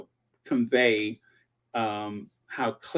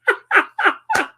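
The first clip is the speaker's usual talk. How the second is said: evil cackle; 5 dB louder than usual. Acted. Gloating at clever plot.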